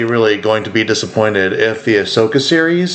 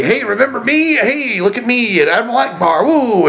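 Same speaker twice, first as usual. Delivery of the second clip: high, over-eager "look at me" cameo voice; mock Admiral Akbar; 10 semitones higher than usual. Mocking Akbar's Force Awakens cameo on a podcast, high hammed-up voice. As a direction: ham up a fan-service cameo begging for attention. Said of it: "it, it was very".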